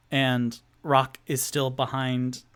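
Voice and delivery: ordinary delivery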